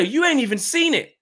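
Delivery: high, insistent objection; high-pitched protest. Real, not acted. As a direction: protest it high in your range. Protesting that friend hasn't seen it.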